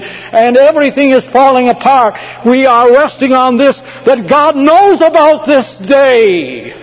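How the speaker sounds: high, loud preacher's proclamation; full-force preaching. Real, not acted.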